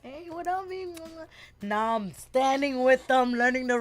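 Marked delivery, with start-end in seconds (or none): 0.0-0.9 casual greeting. Retold or imitated. Dancers greeting her.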